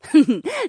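high, bright giggle; a quick amused laugh before speaking; light laugh. Acted. Dryad Polina laughing at Linnea's worry.

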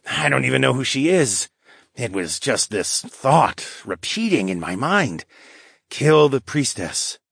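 troubled lynx man. Acted. Lynx character voiced beside narration.

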